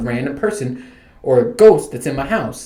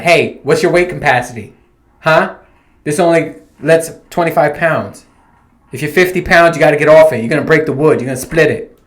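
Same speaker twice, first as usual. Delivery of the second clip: raised, insistent warning voice; mock telling-off; 2 semitones higher, 5 dB louder than usual. Host imagines scolding boy about rocking horse, raised mock warning. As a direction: warn them off the toy loudly.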